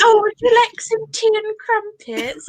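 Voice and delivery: high, hammed-up posh British tea line; mock British accent. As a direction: play an American's cartoon Brit. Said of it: "that's the general American saying".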